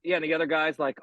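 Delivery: usual speaking voice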